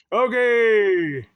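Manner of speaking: big burst of enthusiasm, high and loud; a loud, drawn-out okay that kicks off the next part; loud excited okay. Real, not acted. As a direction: announce it like a game show host. Loud exclaimed transition to new topic.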